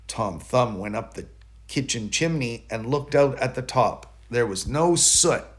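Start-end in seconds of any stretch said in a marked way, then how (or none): none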